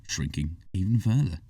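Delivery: plain reading voice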